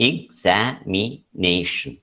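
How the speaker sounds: examination sounded out slowly in separate syllables; examination very slowly, syllable after syllable. Shown as a demonstration. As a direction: break examination into spaced syllables to locate the stress. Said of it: "The stress is on the third syllable".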